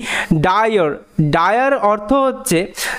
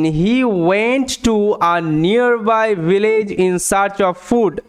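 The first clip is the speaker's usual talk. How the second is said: very slow, word by word; slow teacher read-aloud; slow, clear read; much slower than usual. Shown as a demonstration. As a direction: read slowly so students catch every sound. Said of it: "ভালো মতো দেখো".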